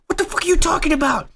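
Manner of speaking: high, quick incredulous question; mock indignant disbelief. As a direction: act out a baffled, outraged comeback. Retelling Spider-Man's indignant reaction.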